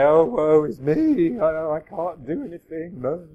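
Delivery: hammed-up self-pity; pathetic whingy. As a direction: moan like a helpless person. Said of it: "pathetic and whingy".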